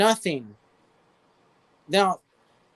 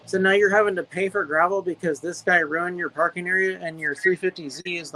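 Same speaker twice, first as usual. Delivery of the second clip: ordinary delivery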